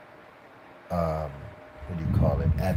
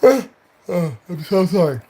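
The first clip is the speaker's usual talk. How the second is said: higher, louder put-on agent voice; agent impression; 10 semitones higher, 6 dB louder, slower than usual. Imitating his agent on the phone, raised put-on voice. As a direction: play the agent, high and loud. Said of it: "he says".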